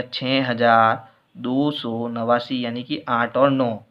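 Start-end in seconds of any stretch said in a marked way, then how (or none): 0.0-2.6 slowed down as if writing it out; slow dictation. Shown as a demonstration. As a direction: spell out the number slowly while writing. Reads the number, then writes it. (लिखते हुए धीरे-धीरे बोलना)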